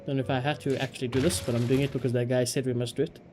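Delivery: ordinary delivery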